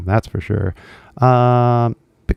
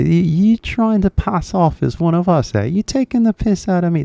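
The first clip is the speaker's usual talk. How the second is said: put-on high-pitched indignant British accent; mock British outrage; 9 semitones higher than usual. British person accusing an impostor, mock-indignant British accent. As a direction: accuse like an affronted Brit, voice pitched up. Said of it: "That's what they would say to me".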